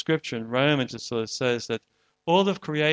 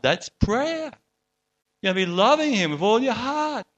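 higher and louder, building joy; fervent preaching; 5 semitones higher, 3 dB louder than usual. Acted. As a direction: lift them toward heaven with full conviction. Rising fervor about heaven.

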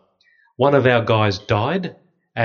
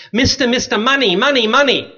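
put-on high pleading child's voice, much higher; begging little girl; 11 semitones higher, 4 dB louder than usual. Little girl begs the priest for money, high pleading child. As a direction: play a street kid tugging at jeans, pleading.

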